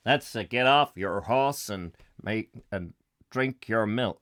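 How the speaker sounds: John Wayne impression. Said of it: "the old Duke".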